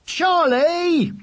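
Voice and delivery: high-pitched outraged call; drawn-out high reprimand; exasperated cry. Acted. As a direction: yell the name at a brother. Duvet thrown down, brother protests.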